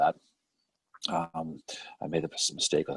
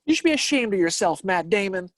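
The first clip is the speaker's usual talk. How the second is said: mock scolding; 10 semitones higher than usual.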